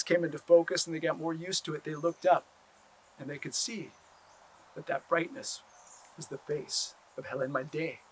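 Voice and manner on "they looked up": plain reading voice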